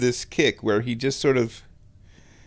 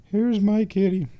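high kitty-cooing voice; pet talk; 9 semitones higher than usual. Real, not acted. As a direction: show off the kitty in a high coo. Cooing to the cat.

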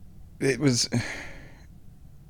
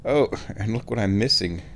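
normal talking voice

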